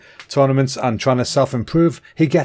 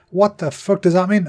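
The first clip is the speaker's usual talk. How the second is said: incredulous; 6 semitones higher than usual. Real, not acted. Reacting to grim trivia.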